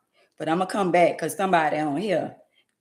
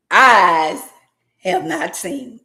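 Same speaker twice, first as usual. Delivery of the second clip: preaching; 2 semitones higher, 7 dB louder, much slower than usual. Real, not acted. Praise repeated.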